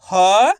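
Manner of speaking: ह held long and loud; letter sound demo. Shown as a demonstration. Teacher asking pupils to repeat, ह sounded long and loud. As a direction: give ह alone as a stretched "haa". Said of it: "आपको मेरे साथ साथ repeat करना है".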